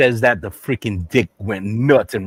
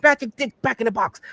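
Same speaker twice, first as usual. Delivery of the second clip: put-on raised-pitch caricatured Chinese accent; mock Chinese accent; 13 semitones higher than usual. Shopkeeper greets a customer, mock Chinese accent. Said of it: "the Chinese man says".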